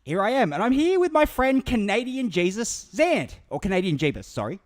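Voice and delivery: loud, high, over-excited Aussie squirrel voice; manic squirrel host. Acted. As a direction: burst in like a hyper squirrel. Hyper host voice intro.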